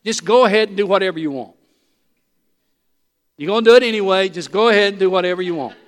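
loud, fast and dismissive, half laughing; with an attitude. Acted. The king brushes off his wife's plea, dismissive and loud. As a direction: toss it off like a fed-up husband. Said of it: "with that kind of an attitude".

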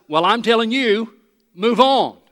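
God commanding Moses; firm command. Retold or imitated. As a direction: lay down the order firmly. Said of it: "And God says".

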